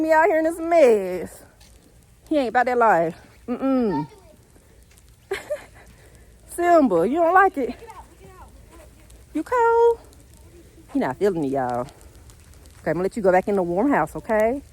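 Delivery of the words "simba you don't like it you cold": higher, louder caring questions to the dog; pet talk. Real, not acted. Owner checking on her senior dog in the snow, high caring pet talk.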